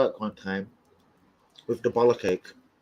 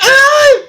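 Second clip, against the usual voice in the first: high loud shriek of pain; retold scream; 15 dB louder than usual. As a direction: scream as a finger gets cut. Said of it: "I heard the scream".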